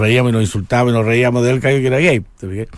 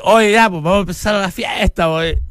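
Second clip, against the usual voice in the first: imitating a half-drunk party host, higher pitch; loosened-tie emcee starting the party, tipsy and higher; 6 semitones higher than usual. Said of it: "ya con la corbata corrida". (animador medio curado, voz más aguda y suelta)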